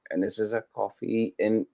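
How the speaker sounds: normal talking voice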